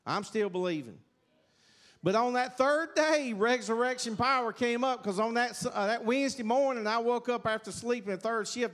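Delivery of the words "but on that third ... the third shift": raised, louder, fervent testimony; preacher building to the healing moment, excited and loud; excited preaching. Real, not acted. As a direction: lift it up like the miracle is happening now. Testimony climax, healing story.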